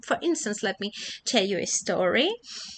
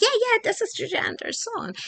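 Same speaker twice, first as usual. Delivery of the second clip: much higher, fast, eager student voice; 14 semitones higher, faster than usual. Retold or imitated. Student eagerly calling out in class. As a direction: jump in gleefully with the answer. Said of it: "suddenly one of them asked".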